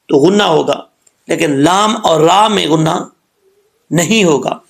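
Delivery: ordinary talk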